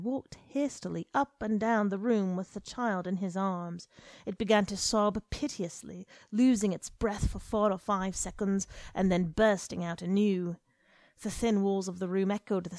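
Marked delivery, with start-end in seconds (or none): none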